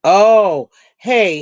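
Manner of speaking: usual speaking voice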